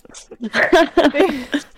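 loud, high burst of laughter. Real, not acted. Host amused at sounding sarcastic by accident, loud laughing. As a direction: crack up laughing hard.

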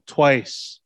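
ordinary talk

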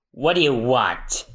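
usual speaking voice

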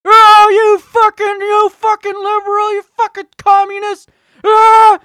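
screeched falsetto insults, slow and drawn out; shrieking mock rage. Mocking an enraged conservative.

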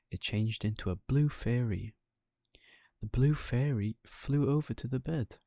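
ordinary delivery, nothing marked

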